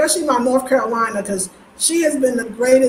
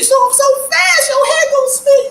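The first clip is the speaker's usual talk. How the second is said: excited warning; 14 semitones higher, 5 dB louder than usual. Real, not acted. Host warning commenters, high and emphatic. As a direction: sing it out teasingly.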